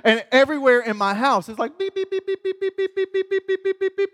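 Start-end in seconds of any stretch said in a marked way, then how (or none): 1.8-4.1 high, fast mock beeping; Geiger beeps. Describing his whole house lighting up, high mock beeping. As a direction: beep like a frantic counter.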